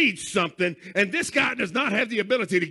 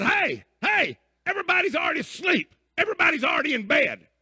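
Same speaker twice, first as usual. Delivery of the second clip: yelling through the door; 6 semitones higher than usual. Retold or imitated. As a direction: shout to wake the house. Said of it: "he's yelling through the door".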